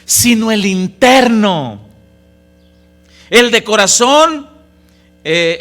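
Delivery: loud, fervent sermon reading; preaching at full force. Acted. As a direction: proclaim Scripture to the congregation. (predicando la Escritura a toda voz)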